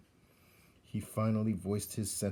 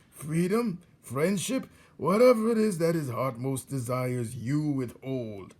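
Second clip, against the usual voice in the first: put-on higher, gloating old rat; old rat Botticelli; 4 semitones higher, 5 dB louder than usual. Old rat teaches young rat how to torture prisoners, gloating. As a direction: savour each cruel instruction.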